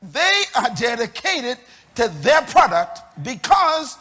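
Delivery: loud, ringing preacher's build; full-voiced sermon climax; preaching at full force. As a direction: drive it home to the congregation.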